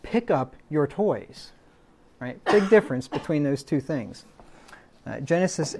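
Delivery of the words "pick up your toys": slow, firm order with a falling end; firm command. Shown as a demonstration. Parent telling a child to pick up toys, slow firm command. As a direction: state it as an order, not a request. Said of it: "There's a difference between that and saying".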